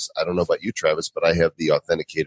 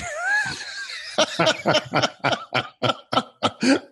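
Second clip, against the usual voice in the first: big high burst of laughter. Real, not acted. Laughs at crack joke.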